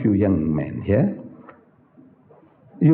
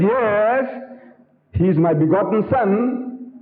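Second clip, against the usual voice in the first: slow, higher, fondly proud claim of a son; mock proud father; 9 semitones higher, slower than usual. Father boasting to companion about Yusuf, slow and sweet. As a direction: croon it sweetly like a doting father.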